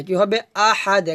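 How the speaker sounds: ordinary talk, nothing marked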